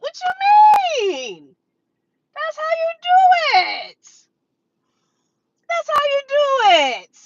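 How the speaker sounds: high, loud, drawn-out triumphant shout; excited shout. Real, not acted. Vlogger celebrating buying the dip, triumphant high shout. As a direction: crow it out in triumph.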